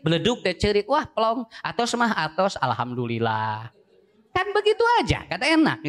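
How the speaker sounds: ordinary talk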